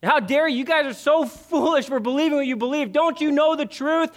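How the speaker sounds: mock furious shouting, high and loud; angry tirade. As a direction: rail at the crowd like an angry zealot. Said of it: "Paul's not running into the temple of Artemis and going".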